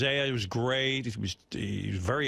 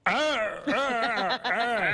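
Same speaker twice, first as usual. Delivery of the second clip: angry Frankenstein; 10 semitones higher, much slower than usual. Retold or imitated. Host mocks J D's appearance on the roundtable, angry Frankenstein groans. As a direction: grunt like an enraged Frankenstein. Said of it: "He was so angry".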